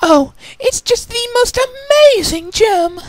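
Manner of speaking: very high, slow swooning lady voice; gushing. Acted. Rarity gushing to Applejack, high and gushing. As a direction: swoon over the gem. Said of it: "she gushed".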